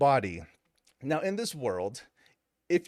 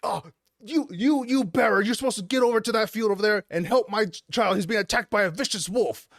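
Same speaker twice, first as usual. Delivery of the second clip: higher, stammered mock ordering; put-on urgent command, pitched up; bossy man; 6 semitones higher than usual. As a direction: act out a father ordering someone to help. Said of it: "this guy was like".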